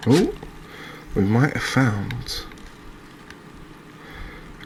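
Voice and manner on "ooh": sudden high, rising "ooh"; surprised ooh. Real, not acted. Reacting to a catch in the game, surprised ooh. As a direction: let a surprised little exclamation slip out, pitch jumping up. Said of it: "I'm sorry if my ooh".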